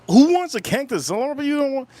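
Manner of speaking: high-pitched mock disbelief; incredulous scoff. Joking about raffling off his cactus, incredulous. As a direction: scoff at the idea, voice jumping up.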